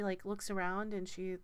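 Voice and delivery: usual speaking voice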